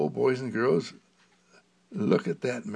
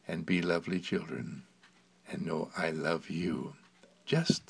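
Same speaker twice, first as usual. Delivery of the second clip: softer, lower, gentle farewell; tender goodbye; 7 semitones lower, 6 dB quieter than usual. Real, not acted. Mister Candle saying goodbye to the boys and girls, soft and tender. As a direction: hush it like a bedtime goodbye.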